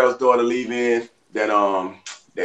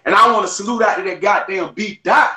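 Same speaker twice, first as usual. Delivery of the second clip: excited; 7 semitones higher than usual. Real, not acted. Hyping up a friend's beard.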